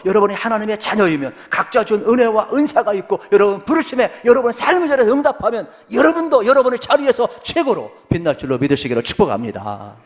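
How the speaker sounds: loud, high-pitched sermon climax; full-force preaching. Real, not acted. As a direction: proclaim the blessing to the congregation at full force.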